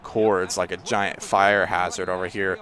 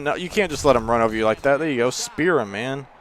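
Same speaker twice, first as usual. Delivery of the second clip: ordinary delivery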